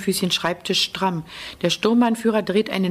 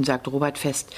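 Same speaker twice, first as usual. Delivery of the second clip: ordinary delivery, nothing marked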